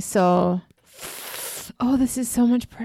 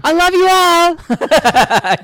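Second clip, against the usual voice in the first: mock acceptance speech; 9 semitones higher, 11 dB louder, much slower than usual. Mock acceptance speech before winner.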